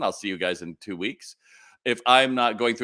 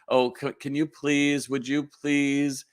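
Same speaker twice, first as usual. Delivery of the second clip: higher, slow, pleading mock request; mock begging; 6 semitones higher, slower than usual. A boss begging an employee, mock pleading. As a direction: plead like a boss too timid to ask. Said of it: "begging someone to do their job".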